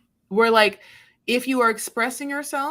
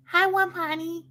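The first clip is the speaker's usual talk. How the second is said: mock babytalk greeting; 9 semitones higher than usual. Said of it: "you weren't like".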